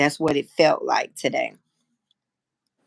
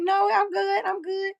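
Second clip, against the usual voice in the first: much higher, fake-cheerful "I'm good"; 14 semitones higher than usual. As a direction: throw the voice up high to hide the tears. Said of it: "when I throw that voice high".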